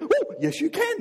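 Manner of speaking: high, playful comeback; triumphant retort. Acted. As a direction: cheerfully overrule the doubters. Jesus answering the theology students.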